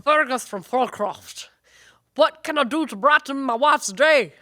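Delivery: a hammed-up Scottish brogue for the listener question; Scottish accent. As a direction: read it like a Scotsman from Fulcroft. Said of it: "I think you should read it in that accent then".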